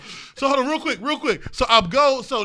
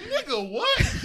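higher, slow, laughing; stunned disbelief; 11 semitones higher, 7 dB quieter, slower than usual. Retold or imitated. Reacting to the infomercial, slow higher disbelief. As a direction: drag out the shocked question.

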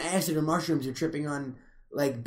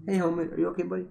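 talking to a dog; much faster than usual. Real, not acted. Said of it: "I'm talking to my dog".

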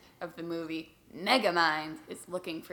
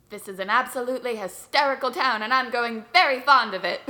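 put-on old Hollywood leading-man delivery, a bit louder; fifties movie voice; 3 semitones higher, 8 dB louder than usual. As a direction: play it like a 1950s film star. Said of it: "given [name]'s nineteen fifties voice".

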